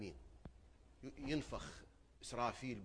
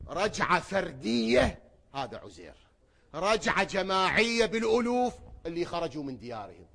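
loud, high, slow sermon climax; fiery preaching; 7 semitones higher, 15 dB louder than usual. Acted. Preacher proclaiming the return to the crowd, loud. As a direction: shout the doctrine out.

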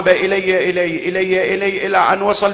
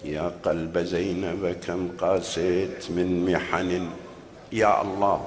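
much lower, softer sorrowful line; soft lament; 10 semitones lower, 9 dB quieter, slower than usual. Acted. Preacher sighing over Zainab's heart, low and soft. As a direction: let it fall to a grieving hush.

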